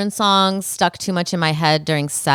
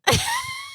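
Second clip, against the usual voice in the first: high giggle; 5 dB quieter than usual. Real, not acted. High giggle after gushing.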